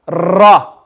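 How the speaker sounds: "ra" with a heavy rolled r; overdone trill. Shown as a demonstration. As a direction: trill the r of "ra" too much. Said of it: "if you do it too much".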